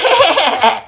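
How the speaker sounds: high-pitched imitated taunting laugh; mocking laugh. Student imitating a boy laughing at them, high mock laugh. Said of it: "starts laughing at me".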